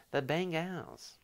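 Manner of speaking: "Bengals" drawn out slowly with an open "ah" ending, bang-gahls; playful mispronunciation. Pretend. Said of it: "Is that like an accent?".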